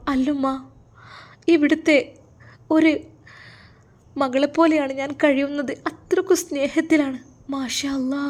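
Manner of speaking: reassure, then give thanks with feeling; lightly voiced emotional women. Daughter reassures mother, who thanks God, emotional.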